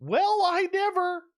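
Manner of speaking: hammed-up offended church lady; scandalized old lady. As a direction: clutch your pearls and drag it out. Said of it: "He's gonna give me a".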